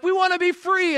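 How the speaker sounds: high-pitched pleading chant of a crowd; mock crying out. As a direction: imitate a people crying out. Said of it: "they're crying out".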